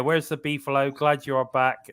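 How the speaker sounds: ordinary delivery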